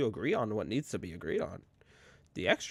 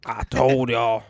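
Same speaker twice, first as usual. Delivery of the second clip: a hint of an old Southern man, loud on the end; 2 semitones higher, 12 dB louder, much slower than usual. Joking about his future self, a hint of Southern, louder. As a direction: lightly play a smug old Southern man. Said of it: "gonna be out there like".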